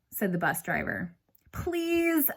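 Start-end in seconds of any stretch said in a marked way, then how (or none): 1.5-2.3 pleading. A narrator's reading. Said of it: "I said".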